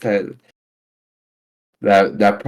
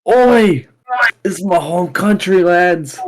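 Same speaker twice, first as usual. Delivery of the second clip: a player cheering for his Galar homeland in a mock British voice; mock British shout; 7 semitones higher, slower than usual. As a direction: belt it like a proud British lad. Player hams up being from Galar.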